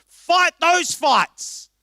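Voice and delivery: slow, loud, high charge; preacher's call to fight; rallying cry. Real, not acted. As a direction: drive each word home.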